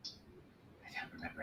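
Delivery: whispering. Acted. Envoy whispers to the newcomer.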